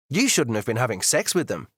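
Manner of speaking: ordinary delivery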